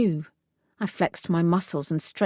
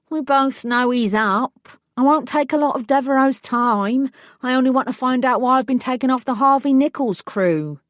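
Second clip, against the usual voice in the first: higher, louder whiny man pleading; whining; 6 semitones higher, 5 dB louder than usual. Acted. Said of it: "had a whining quality".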